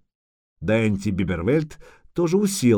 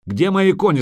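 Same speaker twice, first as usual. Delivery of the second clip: higher, insistent angry question; heated, raised-pitch interrogation; angry demand; 8 semitones higher, 4 dB louder than usual. Acted. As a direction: demand it impatiently. Repeats demand after a threat.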